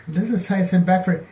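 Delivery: high, excited gasp of recognition; a starstruck fan spotting a model in a store. Retold or imitated. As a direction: say it like you can't believe who's in front of you. Starstruck on meeting celebrity.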